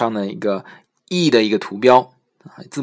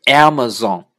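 Amazon, first A stressed; 5 dB louder, slower than usual. Shown as a demonstration. Teacher giving the correct stress on Amazon. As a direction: put the weight on the first A of Amazon. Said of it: "应该是Amazon".

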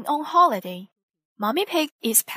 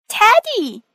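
high, loud joyful cry of the name; Teddy! called out in delight, pitched high; 12 semitones higher, 10 dB louder than usual. A narrator's reading. Peppa greeting her returned bear with a high, loud happy cry. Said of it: "cries Peppa".

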